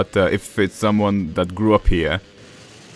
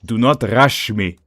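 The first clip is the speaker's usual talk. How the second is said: thick, emphatic Slavic accent; Slavic-accented English, punched out; 3 semitones higher, 4 dB louder than usual. Shown as a demonstration. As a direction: say it like a Slavic speaker, firmly. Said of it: "So basically a Slavic accent".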